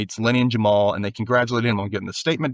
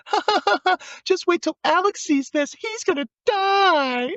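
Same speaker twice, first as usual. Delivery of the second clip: much higher, slow cackling schoolgirl voice; mocking girl laughing. Imitating the mean girls in the hallway, high mocking laugh.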